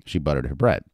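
usual speaking voice

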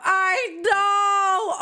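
high, excited shout; worked-up high exclamation; fangirl outburst. Real, not acted. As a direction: squeal it in hearty agreement. Gushing over a celebrity's costume.

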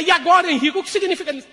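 very high, loud demand; outraged wife. Acted. Wife confronting husband about the band, high loud demand. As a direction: shriek the accusation.

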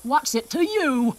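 slow, menacing villain voice; slow tough-guy drawl; grumpy coyote. Acted. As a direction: growl it out like a bandit.

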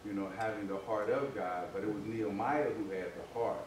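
usual speaking voice

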